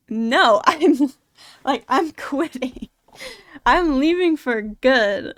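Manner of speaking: loud retold refusal with a laugh; emphatic refusal. Retelling own answer to pleading managers, emphatic refusal. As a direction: refuse firmly, drawing out the last words.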